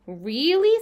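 high, loud astonished question; wide-open surprise; amazed. A narrator's reading. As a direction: swoop up in wonder. Pig amazed at spider's warning.

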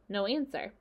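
ordinary delivery

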